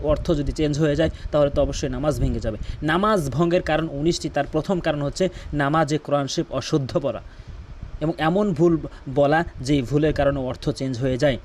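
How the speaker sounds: ordinary talk, nothing marked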